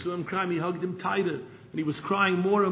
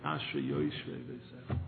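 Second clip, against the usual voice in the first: lingering prayer; 13 semitones lower, 7 dB quieter than usual. Retold or imitated. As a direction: savour each word like a long prayer. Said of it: "For a half hour, he said".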